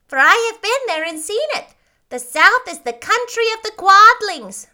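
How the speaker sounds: high, loud little Munchkin voice; second Munchkin. A narrator's reading. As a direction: pipe up loudly as a tiny townsman.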